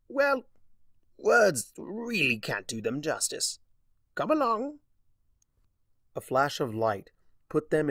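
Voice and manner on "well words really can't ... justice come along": much higher, sing-song flourish; brightly voiced Discord flourish; showman Discord. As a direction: lure her on grandly.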